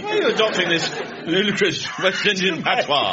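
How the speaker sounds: loud, high protest; mock indignation. Son questions dad's patois, mock indignant. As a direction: challenge Dad with hammed-up outrage.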